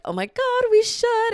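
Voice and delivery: eager friend. Retold or imitated. Imitating Danny begging her to join in, high and eager. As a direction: squeal the suggestion way up high.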